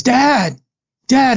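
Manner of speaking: put-on high-pitched kid calling for dad; imitated child's call. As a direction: call out high, imitating a daughter summoning her father.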